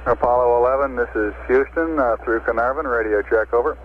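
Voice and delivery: radio transmission; crackly radio voice; over a pilot headset. Real, not acted. Air-to-ground radio check.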